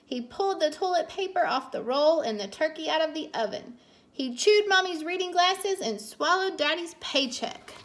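ordinary delivery

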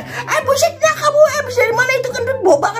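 high squeaky disgusted yelp; father squealing at a fart; disgusted dad voice. Acted. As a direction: recoil from the smell, laughing. Father reacts to kid's fart.

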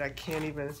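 usual speaking voice

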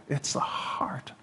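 softly whispered, slow; slow hushed whisper. Real, not acted. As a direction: lean in and whisper it. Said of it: "whispered point".

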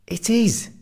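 high-pitched defensive retort. A narrator's reading. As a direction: jump to your own defence, pitched right up.

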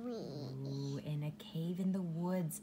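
hushed, unvoiced storytelling; spooky whisper. A narrator's reading. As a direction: whisper as if the bear might wake.

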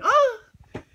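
very high, short, loud "ah"; womanish mock scream. Boxer mocks himself throwing things at a bat, womanish scream. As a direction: squeal it like a scared woman. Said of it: "throwing shit like, like a woman".

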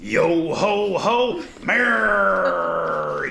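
slow, drawn-out pirate greeting. Acted. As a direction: ham it up like a jolly pirate. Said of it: "it said pirate voice, please".

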